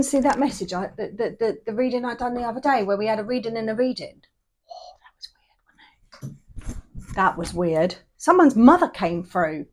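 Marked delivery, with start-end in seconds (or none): 4.7-7.1 hushed whisper, slowing down; whispered aside. Real, not acted. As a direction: lean in and whisper the gossip. Gossipy aside about weird reading.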